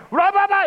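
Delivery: loud, high burst of babble; sudden shouted gibberish; mock speaking in tongues. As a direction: blurt out nonsense syllables like ecstatic tongues. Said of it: "he starts speaking in tongues".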